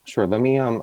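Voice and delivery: usual speaking voice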